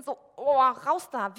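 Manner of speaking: sudden loud, startled cry to break free; panicked outburst. Pretend. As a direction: shout yourself out of the thought spiral. Acted panic about circling thoughts. (plötzlich lauter, erschrockener Ausruf)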